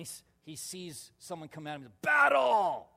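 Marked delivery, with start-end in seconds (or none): 2.0-2.9 loud, high war cry; yelled battle cry. Retold or imitated. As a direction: bellow it like a charging angel. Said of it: "He just yells".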